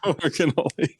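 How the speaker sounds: amused, laughing repeat of the punchline. Real, not acted. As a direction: echo the jab through laughter. Laughing at co-host's cannoli jab.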